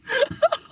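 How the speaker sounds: cracking up in a high giggle; helpless high laughter at your own joke; high-pitched laugh. Real, not acted.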